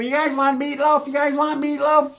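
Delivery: high, singsong hostess offering food; happy mother. Retold or imitated. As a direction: chirp it like a delighted mom. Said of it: "she's all happy".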